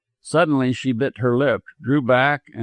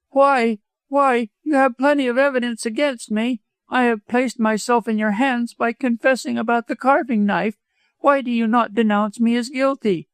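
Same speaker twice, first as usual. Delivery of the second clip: high-pitched, vehement widow; 10 semitones higher than usual. A narrator's reading. Widow dares doctor to denounce her, vehement. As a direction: challenge him in a high, urgent voice. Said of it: "by her vehemence".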